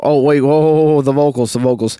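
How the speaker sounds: loud, startled whoa; sudden surprise. Real, not acted. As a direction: catch yourself, suddenly remembering. Suddenly remembers the vocals.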